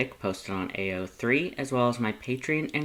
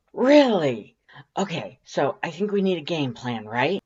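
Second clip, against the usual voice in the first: loud, high burst; excited; 4 semitones higher, 6 dB louder than usual. A narrator's reading. Undyne hyped up, pitching a plan to her friend. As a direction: bounce in, hyped.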